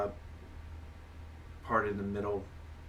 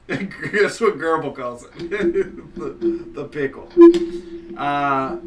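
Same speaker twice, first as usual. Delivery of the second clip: laughing; 6 semitones higher, 12 dB louder than usual. Real, not acted. Laughing over the joke.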